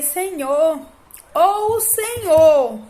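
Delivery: slow, high, loud reenacted call; loud ultimatum. Mother giving God an ultimatum about her son, loud cry. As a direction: cry it out slowly, demanding an answer from God.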